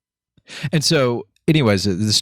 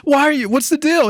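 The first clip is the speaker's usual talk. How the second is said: fake innocent; 3 dB louder than usual. Feigning innocence while laughing.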